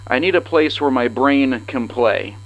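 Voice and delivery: usual speaking voice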